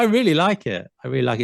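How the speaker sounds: ordinary talk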